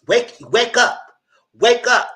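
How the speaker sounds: high, loud exasperated call; shouting wake up. Real, not acted. Vlogger shouting at clueless viewers, loud and exasperated. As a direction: yell it to shake them awake.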